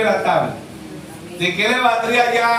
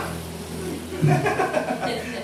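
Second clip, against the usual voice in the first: unvoiced high chuckle; airy laugh; 8 semitones higher, 7 dB quieter than usual. Real, not acted. Preacher laughing after mocking accusers, airy chuckle. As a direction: laugh breathily at the joke.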